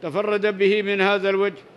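ordinary talk, nothing marked

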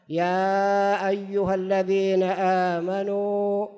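slow, measured recital of a verse; Quran recitation. Acted. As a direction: recite it solemnly with long drawn-out vowels. (تلاوة متأنية بمدود طويلة)

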